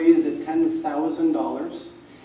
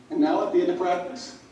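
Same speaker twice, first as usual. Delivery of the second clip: ordinary delivery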